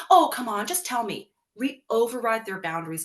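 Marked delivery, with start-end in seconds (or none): 0.1-1.3 higher, louder wheedling; pushy mom. Retold or imitated. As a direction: press the child to talk. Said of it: "our kid is like".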